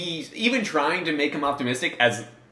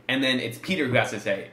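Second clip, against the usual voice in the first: ordinary delivery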